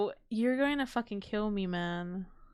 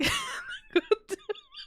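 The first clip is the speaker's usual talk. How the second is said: laughing till tearful; high laughing cry; crying laughter. Real, not acted. Laughing to tears.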